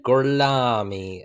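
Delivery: slow, drawn-out mock accent; Brad Pitt's accent. Imitating the thrown-off character's one word, exaggerated accent. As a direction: drag out the syllables in an overdone accent. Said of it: "he's like".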